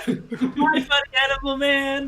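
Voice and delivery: put-on high-pitched little-girl voice. Little girl greets the animal conjurer, high child voice. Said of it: "The little girl lays back".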